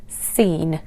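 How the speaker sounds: seen, one long EE after a plain S; slow, deliberate seen with an S sound; mistaken pronunciation. Shown as a demonstration. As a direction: misread the name Sean as seen. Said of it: "thought that this was pronounced as".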